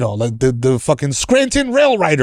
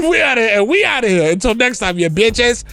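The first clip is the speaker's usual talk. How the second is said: higher hype show sign-off; hype outro; 5 semitones higher than usual. Acted.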